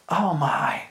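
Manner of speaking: breathy hushed oh my; whisper. Real, not acted. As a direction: let it out under your breath. Whispered aside.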